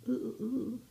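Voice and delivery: high tense little oohs; nervous oohs. Real, not acted. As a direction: wince through a few quick oohs. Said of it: "sorry about the sound effects".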